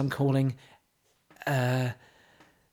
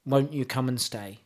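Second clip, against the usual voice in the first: mock poetry recital; 4 semitones lower, 4 dB quieter than usual. Host reciting pop lyrics to co-host, mock solemn. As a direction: recite it like deep poetry, deadpan.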